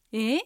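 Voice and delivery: "え" rising like "pardon?"; asking back. Shown as a demonstration. Teacher likens second tone to asking back, rising. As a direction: lift "え" as if you didn't catch it. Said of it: "聞き返すように上がる音".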